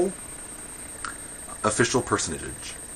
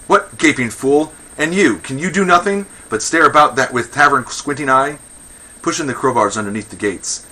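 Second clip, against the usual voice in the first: raised, higher voice of exasperation; indignant scolding; 6 semitones higher, 9 dB louder than usual. Acted. Magistrate berating his idle archers, indignant scolding. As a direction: berate them in theatrical outrage.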